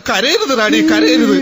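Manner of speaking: hammed-up comforting cry; mock consoling wail. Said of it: "മതി പടാ, ഇനി ഞാൻ". (റാണിയോട് ഉറക്കെ കരയരുതെന്ന് അപേക്ഷിക്കുന്നു)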